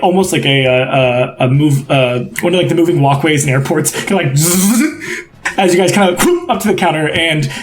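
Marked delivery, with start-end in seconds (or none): 4.4-4.8 imitated "zzz" of a machine; short mechanical hum; buzzing walkway noise. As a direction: buzz like a moving walkway.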